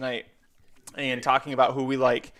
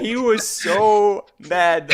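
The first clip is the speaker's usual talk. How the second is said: emphatic; 6 semitones higher, 6 dB louder, much slower than usual. Real, not acted. Recalling Dan's anger.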